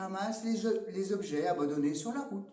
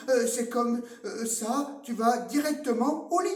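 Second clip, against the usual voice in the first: louder, higher scolding; mock angry parent; 6 semitones higher, 6 dB louder than usual. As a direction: scold like a fed-up dad.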